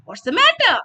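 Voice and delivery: bright happy demo. Shown as a demonstration. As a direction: sound delighted to see them. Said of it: "When you're happy".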